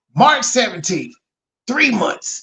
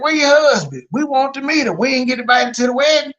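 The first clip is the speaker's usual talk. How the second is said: mock questioning from the family, higher pitched; indignant relatives, imitated; 4 semitones higher, 3 dB louder, faster than usual. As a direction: imitate relatives demanding an explanation. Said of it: "Your nieces and your sister like".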